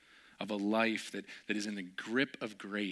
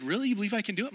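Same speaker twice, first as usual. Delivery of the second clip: high, loud eager asking; eager question; 10 semitones higher, 5 dB louder, much faster than usual. Retold or imitated. Friend eagerly asking to shoot, high and louder. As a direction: ask eagerly.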